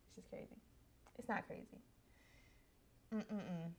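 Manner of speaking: near-silent, broken words fighting tears; choked up. Real, not acted. Bride watching her wedding video, fighting back tears. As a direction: barely get it out, swallowing tears. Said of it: "I am getting emotional".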